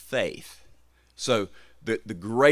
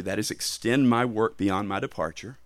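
ordinary talk, nothing marked

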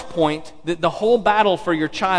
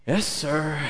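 put-on reluctant child; 2 semitones lower, 6 dB quieter than usual.

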